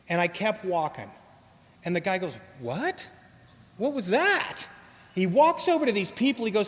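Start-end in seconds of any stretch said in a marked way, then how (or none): 2.6-4.7 stunned. Retold or imitated. Stunned old victim reacts.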